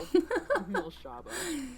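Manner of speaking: high, light giggle; real chuckle at your own remark; amused laugh. Real, not acted.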